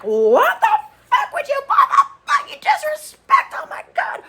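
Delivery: very high, loud shouting rant; laughing mock fury; mock furious shriek. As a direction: explode like she did, laughing. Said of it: "she starts fuming".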